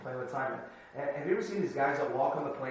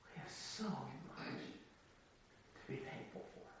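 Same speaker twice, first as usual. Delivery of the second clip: much softer, slow, nearly breathless; soft intimate reminder; hushed; 2 semitones lower, 22 dB quieter than usual. Real, not acted. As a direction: draw them in with a near whisper. Intimate, hushed sermon moment.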